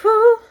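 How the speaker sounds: big loud nervous exhale, pitched high; loud high whew. Real, not acted. Vlogger bracing to draw the next prompt, loud whew. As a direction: blow out a loud anxious whew.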